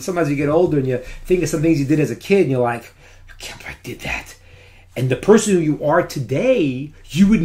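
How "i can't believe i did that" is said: whispered, unbelieving, to oneself; incredulous whisper. Retold or imitated. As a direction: breathe it out in disbelief. Remembering own childhood deeds.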